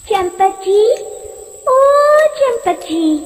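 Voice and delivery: high, sing-song call from afar; calling witch. Acted. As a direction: lure him by name, sweetly. Witch calls him on the road. (दूर से मीठी पुकार लगाती चुड़ैल)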